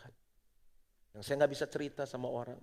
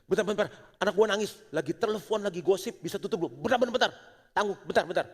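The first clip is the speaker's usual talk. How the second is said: higher, louder, fast hurried brush-off; flustered parent; 5 semitones higher, 5 dB louder, faster than usual. Retold or imitated. Parent hanging up the phone to run to a crying child, flustered and fast. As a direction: rush off the phone in a panic. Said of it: "Apa yang kita lakukan?".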